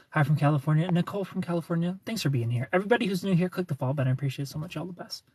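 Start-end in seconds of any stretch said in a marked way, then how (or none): none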